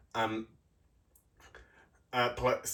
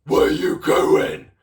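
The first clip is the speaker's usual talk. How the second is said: loud, slow, rasping troll demand; storybook troll barking a question; 4 semitones higher, 8 dB louder, slower than usual. Acted. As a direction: growl it at the little goat. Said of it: "And the troll says".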